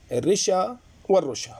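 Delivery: الرشا first with short i, then with short u; kasra, then damma. Shown as a demonstration. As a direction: say الرشا as "risha", then as "rusha". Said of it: "بالضم والكسر". (الرشا بكسر الراء ثم بضمها)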